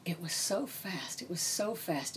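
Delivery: hushed, whispered trance reading; imitated psychic whisper. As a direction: whisper it as if receiving a vision.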